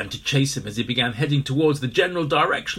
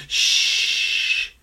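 shushing; 6 dB louder than usual. A narrator's reading. Everyone shushing Aloysius' hmm. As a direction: hush him up. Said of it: "went everyone else".

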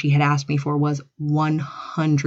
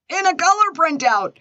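much higher, emphatic exclamation; high-pitched protest; 11 semitones higher than usual. Real, not acted. Defending her color printing to listeners, high-pitched protest. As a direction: push the pitch right up in protest.

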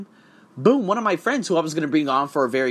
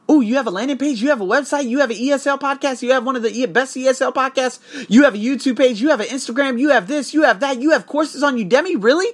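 high, amazed, piling up the list; impressed viewer; 10 semitones higher, 4 dB louder than usual. Pretend. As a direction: play a stunned visitor gushing over everything. Imagined impressed visitor to page.

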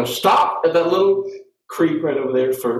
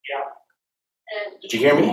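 ordinary talk, nothing marked

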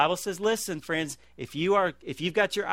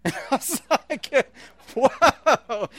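louder, higher laughing gasp of surprise; startled laughing whoa; 7 semitones higher, 6 dB louder, much slower than usual. Retold or imitated. Pastor retelling being startled by the alarm, laughing. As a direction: laugh as if jolted out of sleep.

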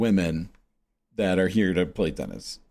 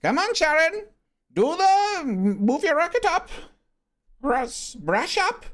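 high-pitched, hammed-up South African coach; badly faked South African accent, urging a student on; horrible South African accent; slower than usual. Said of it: "Well, it's a horrible accent".

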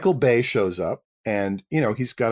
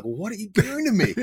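higher, pleading, mock despair; exasperated plea; 8 semitones higher than usual. Actor dismayed at the new lines from the director, mock despair. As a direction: throw up your hands in mock despair.

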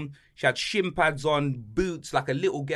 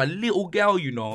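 usual speaking voice

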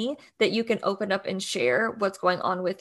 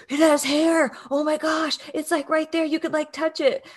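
higher-pitched, imitating a thrilled new dad; excited dad; 6 semitones higher than usual. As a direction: act out a dad's giddy discovery. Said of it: "they get so excited".

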